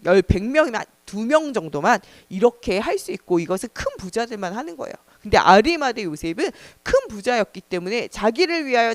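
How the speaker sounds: ordinary talk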